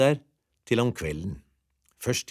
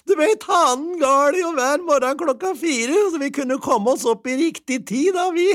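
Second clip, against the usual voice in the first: rising to much higher, excited; wife sending children for the sheep; gleeful wife; 14 semitones higher, 4 dB louder than usual. A narrator's reading. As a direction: build up to a happy call. Wife's happy voice.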